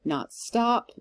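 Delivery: deliberate iambic beat landing on "stop"; stress on STOP. Shown as a demonstration. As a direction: lean on "stop", keep "not" light. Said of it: "more emphasis on stop than on not".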